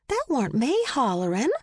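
high, swooping innocent denial; high-pitched protest of innocence; feigned innocence. A narrator's reading. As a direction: deny it all sweetly. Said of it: "she said, looking innocent".

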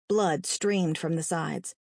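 ordinary delivery

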